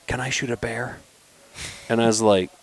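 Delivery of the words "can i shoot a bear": softer, slightly raised hushed voice; retold whisper. Son asks dad about a bear, in a mock whisper. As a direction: imitate a boy asking under his breath. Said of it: "he's whispering".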